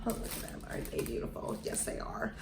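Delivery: soft doting whisper; whispering to the shoes. Real, not acted. Cooing at the shoes.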